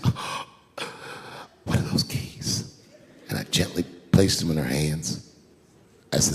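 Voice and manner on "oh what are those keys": small, slow whimper; soft, breathy question through mock tears; tearful near-whisper; imitated tearful wife. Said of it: "And she's crying".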